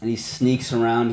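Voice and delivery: usual speaking voice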